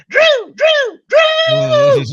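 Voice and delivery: high, loud frantic yelling of a name; mock him shrieking for his agent in a panic; mocking panicked shout. Mocking a player yelling for his agent, shrill panicked shout. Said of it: "the reason I mock him".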